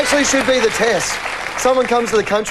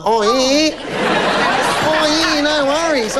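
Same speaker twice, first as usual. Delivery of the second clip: higher, hammed-up Aussie voice; exaggerated Aussie impression, pitched up; broad Aussie accent; 6 semitones higher, slower than usual. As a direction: lay the Aussie on thick. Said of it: "you Aussie up so much".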